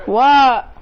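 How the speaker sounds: a sudden loud outraged what. Acted. A listener reacting to the joke, loud and disbelieving. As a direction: blurt it out in disbelief.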